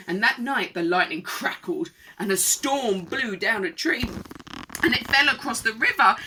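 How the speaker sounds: lively, projected read-aloud; rhythmic storybook telling; children's story narration. A narrator's reading. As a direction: read it to small children with relish. Reads a picture book.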